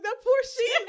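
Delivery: high, loud, laughing "poor sheep"; mock pity. Cracking up while pitying the sheep, high and loud. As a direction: squeal it out, cracking up. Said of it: "I was like".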